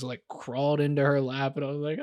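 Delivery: normal talking voice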